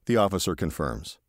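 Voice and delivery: usual speaking voice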